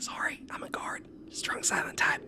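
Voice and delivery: sheepish stage whisper; whispered apology. Acted. Guard apologizing after being shushed, soft whisper. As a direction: whisper it softly, barely any voice.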